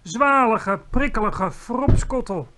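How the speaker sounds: high, loud, relishing each word; emphatic exclamation. A narrator's reading. Giant names his fizzy drink, exclaiming emphatically.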